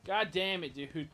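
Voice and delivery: loud frustrated curse; angry outburst. Real, not acted. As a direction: snap at the game in anger. Game lock-on fails again.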